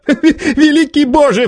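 high-pitched, drawn-out cry; theatrical exclamation; exclaiming. A narrator's reading. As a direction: cry out to heaven, slowly and high. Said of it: "вскричал Варбек".

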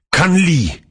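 high-pitched cry without much added volume; acted cry. Feyd-Rautha cuts in, straining against guards, crying out. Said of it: "крикнул Фейдраут".

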